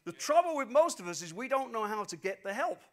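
loud, driving sermon delivery; preaching at full force. Real, not acted. Pastor urging listeners that the Spirit helps them, loud. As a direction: press the promise home to the crowd.

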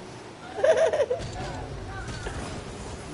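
breathless wheezy laughter; laughing so hard no voice comes out; wheezing laugh. Real, not acted. Laughs at own joke.

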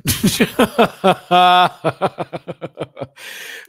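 long, high, loud belly laugh; big cackle. Real, not acted. Host cracking up at their own rambling, big cackle. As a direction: burst out in rolling laughter.

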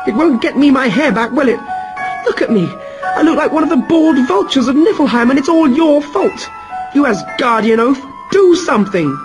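high, fast, shrill-free furious yell; angry shouting Sif. Acted. Sif shouts at Thor over her lost hair. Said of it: "But Sif was even angrier than Thor and shouted".

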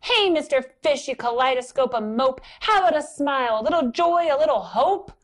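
high, loud, sassy squid voice; impolite squid. Acted. Impolite squid taunting the fish, high and loud. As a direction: needle him like a rude squid. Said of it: "Along comes a squid".

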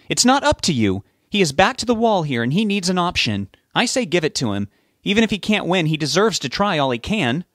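high-pitched insistence; heated arguing. A narrator's reading. Betty argues for Rob against her husband, heated. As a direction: press the case hotly, voice up.

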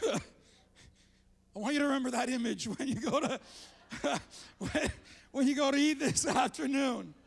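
laughing through the words. Real, not acted. Preacher laughing at his vomit image before lunch, real laughter. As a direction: chuckle while you finish the sentence.

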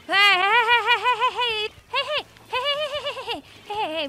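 rapid high "hey"s, fading; calling out. Real, not acted. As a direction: call out to grab attention. Calling teammates urgently.

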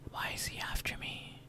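soft scared whisper; scared whisper asking why the monster chases him. Real, not acted. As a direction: whisper it fearfully. Whispers in scary game.

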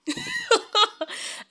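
a sudden squealing laugh; high burst of laughter. Real, not acted. Host cracking up at her own plea to Ruth, laughing high.

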